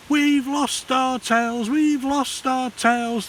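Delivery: high, slow, gleeful cheer. Acted. Froglets cheering that their tails are gone, high and slow. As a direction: sing out the happy news together. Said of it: "they cheered".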